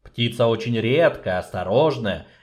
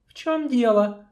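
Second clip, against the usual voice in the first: higher, cool female voice; sorceress voice; 14 semitones higher than usual. Acted. Sorceress brushing off the dwarf, cool and high. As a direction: ask without looking up. Sorceress answers without looking up.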